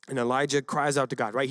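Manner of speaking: usual speaking voice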